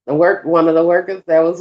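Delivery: ordinary delivery, nothing marked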